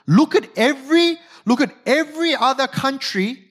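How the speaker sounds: high-pitched taunting boast; mocking. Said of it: "The mocking continues".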